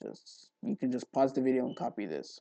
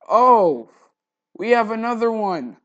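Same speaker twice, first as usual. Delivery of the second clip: real loud burst of surprise, higher pitch; sudden surprised realization; 11 semitones higher, 20 dB louder than usual. Real, not acted. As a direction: blurt it out the moment you spot it. Realizes duplicate object mid-tutorial.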